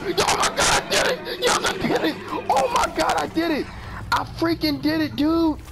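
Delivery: high, shrieking celebration easing down; thrilled triumph. Real, not acted. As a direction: yell it like you can't believe you won. Celebrates surviving in the game.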